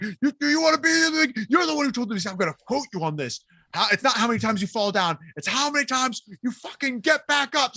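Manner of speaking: high, worked-up mock pep talk; imitating fired-up coach. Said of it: "fired up".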